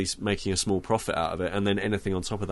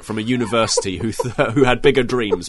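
loud, laughing, grand demand; loud mock request; 3 semitones higher, 9 dB louder than usual. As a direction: announce it loudly while laughing.

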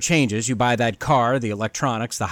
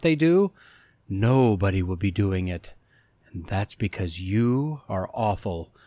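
ominous announcer. Acted. Host welcoming listeners to a spooky podcast, theatrical announcer voice. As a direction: lure listeners in like a haunted house guide.

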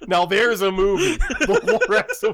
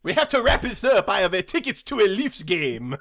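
mock Quebecois. Canadian wanting to leave for a Leafs game, mock French accent. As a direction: hurry the crew along in mock Quebecois. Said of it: "some kind of Quebecois accent".

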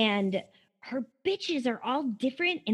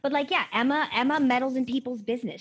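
ordinary talk, nothing marked

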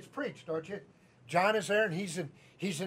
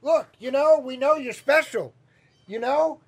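louder, higher acted fatherly talking-to; exasperated father; 5 semitones higher, 7 dB louder than usual. Joseph confronting his special child, exasperated father. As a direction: play a dad reasoning firmly with his child. Joseph reprimands young Jesus in story.

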